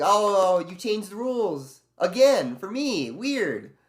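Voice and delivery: high, slow mock-sweet sarcasm; a high, drawn-out sarcastic jab at the bosses. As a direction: drip fake surprise over each word. Sarcastic imagined buzzer line.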